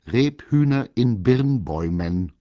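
loud, high, emphatic repetition; stern. A narrator's reading. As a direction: spell out "Rebhühner in Birnbäumen" like a strict teacher. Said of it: "wiederholte das Eichhörnchen streng".

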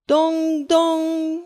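high bell dong; clock chime. A narrator's reading. Clock strikes midnight.

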